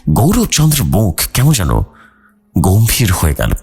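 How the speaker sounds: plain reading voice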